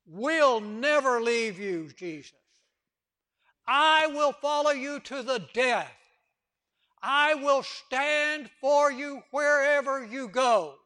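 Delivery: loud, slow, fervent promise; Peter's bold vow. Acted. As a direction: swear it with all your heart.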